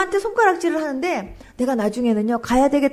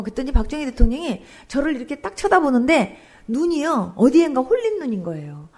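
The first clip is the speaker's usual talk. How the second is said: ordinary talk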